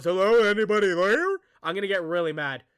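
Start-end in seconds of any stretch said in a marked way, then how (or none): 0.0-1.4 loud, hammed-up call into the dark; mock the dumb horror hero calling out; mocking yell. Streamer mocking the game character who yells into the dark, loud mock call. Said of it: "If he yells right now and he goes".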